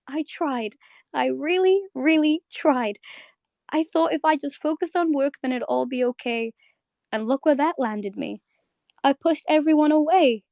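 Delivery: pleading, near tears; Bubblegum breaking down. Retold or imitated. Acting Bubblegum breaking down before Marceline, mildly tearful. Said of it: "watching Bubblegum just kind of break down".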